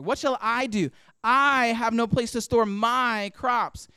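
slow, punching "I" and "my"; stress on I and my. Shown as a demonstration. Preacher showing the rich man's self-focus, stress on I and my. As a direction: lean hard on every "I" and "my", drawn out. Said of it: "notice what I emphasize here".

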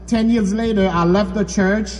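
ordinary talk

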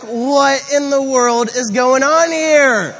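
high, loud, drawn-out disbelief; loud bewilderment. Retold or imitated. Man whose umbrella got kicked, loudly bewildered. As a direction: retell it as an exasperated, incredulous outcry. Acting out his shocked reaction.

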